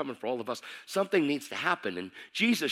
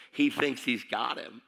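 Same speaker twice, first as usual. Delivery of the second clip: normal talking voice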